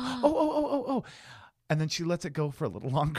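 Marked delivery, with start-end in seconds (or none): 0.0-1.0 very high, loud mock oh-oh-oh; squeaky put-on excitement; flustered excitement. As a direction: imitate someone frantically overexcited. Imitates date reacting to cheese.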